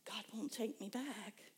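slow, soft and hopeless; despairing. Retold or imitated. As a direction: say it quietly, near tears. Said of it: "I'd be like".

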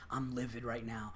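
low, very soft retold complaint; muted livid grumble. Waitress venting within a customer's earshot, muted and livid.

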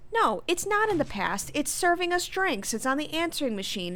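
high, mildly indignant retort; argumentative. Acted. Maria disputing that it's in the past, argumentative.

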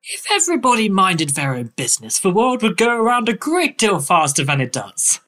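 airy, whimsical Victorian author; dreamy storybook eccentric, slightly lifted; Lewis Carroll. Acted. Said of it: "that, uh, performance".

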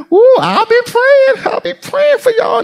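high, whimpering praying; hammed-up weepy prayer; mock crying prayer. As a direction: fake a tearful worried saint. Mocking worried believer.

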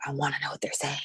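whispered to herself; whispering. Retold or imitated. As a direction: whisper it in longing. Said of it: "I will be like".

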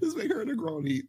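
very high squeaky mock voice; high put-on voice. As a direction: pitch way up, playful. Repeats it in a put-on voice.

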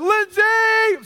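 high loud call of the name. Retold or imitated. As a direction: yell across the house to her. Calling wife from the kitchen.

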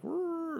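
high little imitated dog whine; mock dog growl. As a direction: voice the dog's whiny growl.

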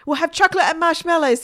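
put-on high, loud decisive boy; excited boy. A narrator's reading. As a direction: announce it like an excited child. Boy decides in a rush.

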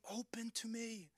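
almost under the breath, tender invitation; hushed voice of Jesus at the door; soft plea. Acted. Jesus heard gently at the door.

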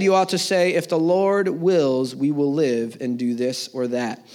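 ordinary talk

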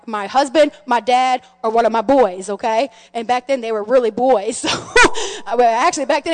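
ordinary delivery

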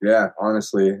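normal talking voice

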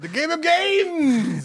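high, slow announcer boom; game show announcer. Acted.

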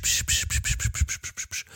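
soft unvoiced psch sounds; imitated hushing. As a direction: hiss psch psch like a dog whisperer. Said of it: "die Frau Novak mit dem Psch".

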